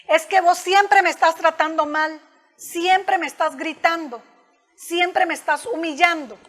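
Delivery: acusación agresiva, más aguda y más fuerte, marcando siempre; aggressive accusation. Shown as a demonstration. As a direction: reprochar a la pareja con rabia contenida. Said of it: "Ahí vamos con la comunicación agresiva". (reproche agresivo con énfasis en siempre)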